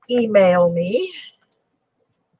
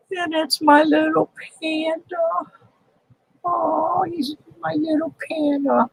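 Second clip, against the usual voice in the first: cooing; 9 semitones higher than usual. Real, not acted. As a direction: coo at it like a pet. Cooing over a cherished coin.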